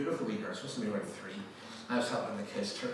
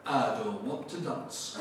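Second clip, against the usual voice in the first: put-on slow small-child voice in Yorkshire accent; slower than usual. Wee girl refusing to dance, slow Yorkshire accent. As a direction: imitate a stubborn Yorkshire toddler. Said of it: "Sheffield is in Yorkshire".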